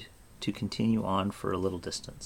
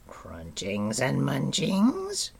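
slow, rising creature voice; wheedling creature asking for food; hopeful creature whisper; 6 semitones higher, much slower than usual. A narrator's reading. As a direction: draw it out slowly, sliding up high at the end. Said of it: "whispered a voice".

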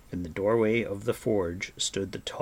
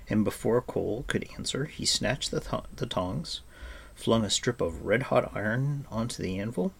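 usual speaking voice

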